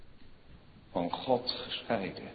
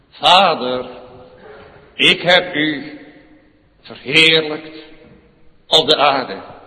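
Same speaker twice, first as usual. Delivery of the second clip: louder cry to the Father; 7 semitones higher, 14 dB louder, slower than usual. Acted. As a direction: call out loudly to the Father. Voicing Jesus' cry. (luide uitroep tot de Vader)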